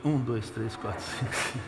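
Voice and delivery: soft, hurried counting under the breath; rapid muttered counting. Shown as a demonstration. Preacher demonstrating counting the crowd, hushed rapid count. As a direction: rattle off the numbers quietly. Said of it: "pera um pouquinho aqui também".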